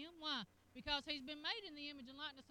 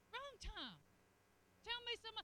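normal talking voice

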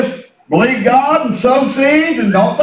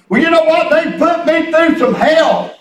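ordinary talk, nothing marked

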